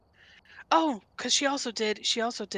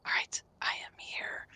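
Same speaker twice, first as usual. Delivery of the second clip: whisper; 5 dB quieter than usual. Real, not acted. Whispered greeting.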